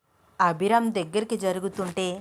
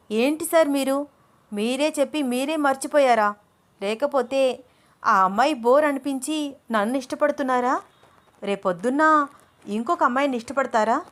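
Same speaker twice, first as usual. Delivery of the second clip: higher, heated reproach; indignant, raised voice; angry; 6 semitones higher, 3 dB louder than usual. Acted. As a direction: hurl jealous questions at him. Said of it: "కోపంగా అంది అను".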